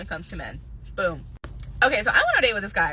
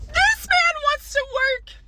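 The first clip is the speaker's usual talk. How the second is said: exaggerated disbelief; 3 dB louder, slower than usual. Real, not acted.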